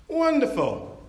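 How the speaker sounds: lightly voiced captain gushing wonderful; cheery captain. Captain cheerfully answering the passenger in a brighter voice.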